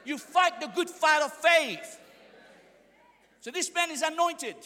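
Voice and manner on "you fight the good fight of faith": ordinary delivery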